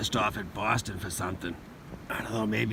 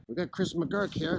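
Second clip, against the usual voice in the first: higher, louder TV anchor voice; news anchor voice; 7 semitones higher, 4 dB louder than usual. Pretend. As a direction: play the studio anchor throwing to the field. Mock newscaster introduces himself.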